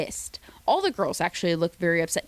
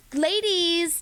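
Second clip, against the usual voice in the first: higher, slower host voice; host announcement; 9 semitones higher than usual. Retold or imitated. Mimicking the host telling the ladies to unmask, announcer voice. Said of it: "it's like".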